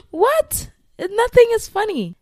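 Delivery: high, loud mock outrage at her own giggle; incredulous outburst. Real, not acted. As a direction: protest with laughter barely held back. Scolding herself for giggling.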